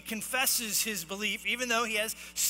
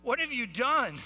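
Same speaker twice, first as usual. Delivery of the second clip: raised, higher-pitched accusing shout; acted yelling; 4 semitones higher, 4 dB louder than usual. Sailors demand answers from Jonah in the storm, acted yelling. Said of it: "they are yelling to one another".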